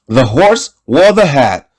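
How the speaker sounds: usual speaking voice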